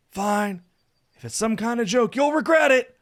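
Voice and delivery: high, loud warning that builds; grudging threat. A narrator's reading. Saphir agreeing to close his eyes, warning. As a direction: give in but threaten payback.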